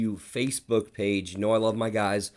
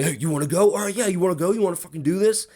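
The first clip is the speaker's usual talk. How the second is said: higher-pitched mock chirping; mock taunting challenge; 9 semitones higher, 4 dB louder, much faster than usual. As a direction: imitate someone chirping for a fight, pitch climbing. Acting out manager chirping at pitcher.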